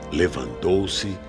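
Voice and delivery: normal talking voice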